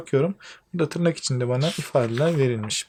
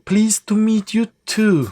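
English line read slowly and higher; reading Betty's line; 7 semitones higher, 5 dB louder, slower than usual. Acted. Teacher reads Betty's answer in the dialogue. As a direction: give Betty's reply slowly, clearly.

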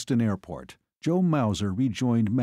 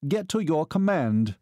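curt officer's command, pitched higher; clipped, higher-pitched military command; clipped order; 6 semitones higher than usual. A narrator's reading. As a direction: cut him off with an order. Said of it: "Balt Haer clipped".

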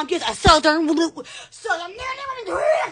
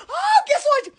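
ordinary delivery

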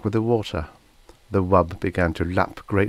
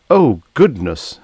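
loud, drawn-out exclamation, pitched up; crying out; 5 semitones higher, 9 dB louder, slower than usual. A narrator's reading. Wub protesting to the men about cutting it open, crying out. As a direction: cry out slowly in dismay. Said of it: "the wub cried".

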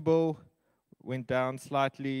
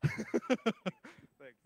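laughing; 9 semitones higher than usual. Real, not acted. Laughs after auctioneer joke.

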